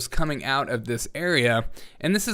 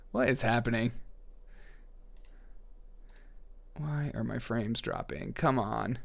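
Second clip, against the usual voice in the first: frustrated mutter; 4 semitones lower, 12 dB quieter than usual. Real, not acted. Frames dropping on stream.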